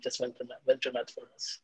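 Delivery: ordinary talk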